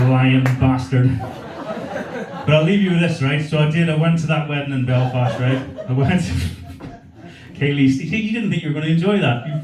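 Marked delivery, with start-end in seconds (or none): 0.1-3.2 drawn out, laughing; slow mock insult. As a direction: tease slowly.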